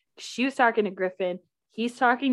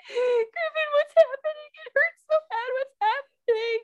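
very high, squealing mock bawl; hammed-up tearful wail; retold big crying. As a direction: bawl like a hurt little kid. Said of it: "big crying".

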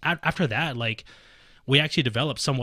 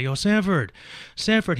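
loud high call across the hallway; 7 semitones higher, 4 dB louder, slower than usual. Retold or imitated. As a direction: shout his name down the hall. Calling out in hallway.

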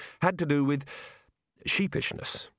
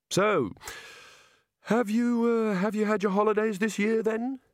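nervous chatter; 10 semitones higher than usual. Acted. Rincewind chatting while clipping nervously.

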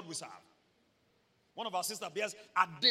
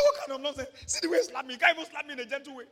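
aggrieved brother; 6 semitones higher, 10 dB louder, faster than usual. Retold or imitated. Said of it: "He said".

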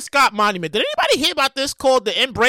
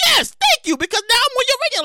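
high, gleeful bragging; proud self; 12 semitones higher, 3 dB louder than usual. Pretend. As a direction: answer the fan with glee. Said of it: "And I'm like".

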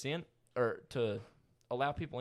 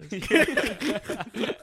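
laughing; 7 semitones higher, 7 dB louder than usual. Retold or imitated. Quoting friend amid laughter.

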